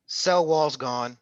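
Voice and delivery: each word of cell wall's gone stretched out slowly; teacher drilling the point, slow; slow emphatic repeat. Shown as a demonstration.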